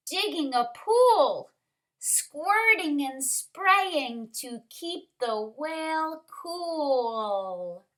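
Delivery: lively rhyming picture-book reading, lifted stresses; story narration. A narrator's reading. Teacher reads rhyming story to kindergarteners, lively narration. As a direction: punch the key word of each phrase.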